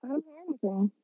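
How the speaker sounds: muffled, lying on earbuds. Real, not acted. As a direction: speak into the pillow, muffled. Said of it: "You sound muffled".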